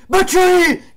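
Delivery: high, loud screamed name. Real, not acted. Name screamed as shot goes in. (nome urlato)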